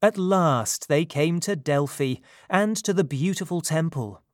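plain reading voice

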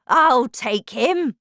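volunteer loudly, stretching the words; eager shout. A narrator's reading. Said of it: "shouted Grandpa Joe".

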